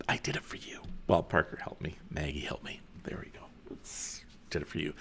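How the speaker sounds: hushed, breathy confiding whisper; whispered aside. Real, not acted. Host confides who really decorated, in a whisper. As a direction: whisper it like a secret to the camera.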